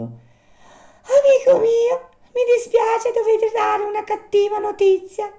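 very high, pitying little voice; sighing marmot. A narrator's reading. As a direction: break bad news in a high marmot voice, drawn out at first. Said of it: "gli disse sospirando".

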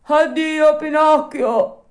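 high, loud, slow grateful goodbye; dog's farewell. A narrator's reading. Alidoro calling goodbye back to Pinocchio, high and loud. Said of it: "rispose il cane".

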